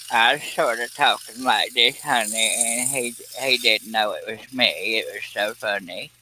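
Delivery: put-on old lady prank-call voice, softening as it goes; elderly woman voice. Shown as a demonstration. Recreating the prank call that fooled dad, elderly woman voice. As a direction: talk like a sweet-tongued elderly woman. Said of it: "elderly woman voice".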